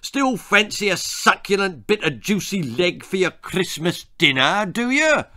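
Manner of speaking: high, taunting interrogation; jeering military turkey; snarling. A narrator's reading. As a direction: goad like a hostile sergeant. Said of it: "he snarled".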